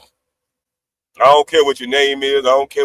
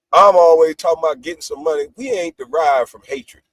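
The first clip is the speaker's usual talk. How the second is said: ordinary talk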